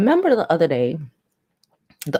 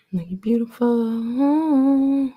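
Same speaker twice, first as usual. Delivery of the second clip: slow, high, seductive purr; mock seductive coo; sultry voice; 8 semitones higher, much slower than usual. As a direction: stretch it out, high and sexy. Sultry voice bit again.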